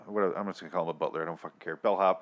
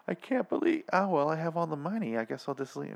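mocking the actress; 7 semitones higher, 3 dB quieter than usual. Imitating the actress's bad line reading.

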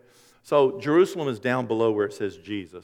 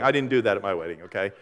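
ordinary delivery

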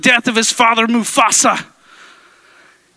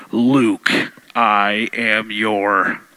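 very low, slow movie villain; deep slow menacing father figure; Darth Vader voice; 4 dB quieter, much slower than usual. Retold or imitated. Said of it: "That's Star Wars, people".

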